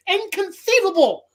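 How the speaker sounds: high, whiny cry of inconceivable. Retold or imitated. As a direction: toss off the famous line in a high whine. Imitating [name]'s famous line.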